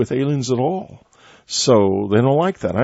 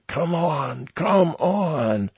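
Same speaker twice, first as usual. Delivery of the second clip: imitated rough voice, higher and drawn out; 6 semitones higher, 5 dB quieter, much slower than usual. Host retelling the voice heard in the woods, imitated rough voice. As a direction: mimic a rough, eerie summons, held back in volume. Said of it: "I heard a very rough voice".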